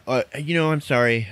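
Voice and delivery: sheepish kid owning up, lightly acted; embarrassed. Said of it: "he's embarrassed".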